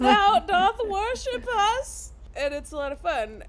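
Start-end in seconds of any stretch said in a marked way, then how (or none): 0.0-2.1 high, slow, drawn-out archaic question; mock archaic witch. Host repeating Winifred's line, high and slow. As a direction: stretch out the old-English words. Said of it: "she's like".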